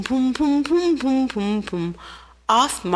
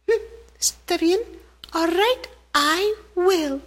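much higher tiny ant voice, resolved; little ant agreeing; 9 semitones higher than usual. Acted. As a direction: chirp a determined okay as the little ant.

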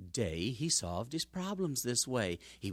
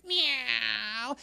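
high cat meow; 9 semitones higher, 4 dB louder than usual. Acted. Cat luring mouse.

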